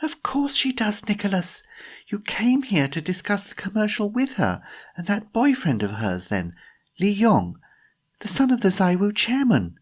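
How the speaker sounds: high, incredulous reminder; surprised. A narrator's reading. Jemima reminds Timothy he met Tanya, surprised high voice. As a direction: answer in high-pitched, emphatic surprise. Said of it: "Jemima looked surprised".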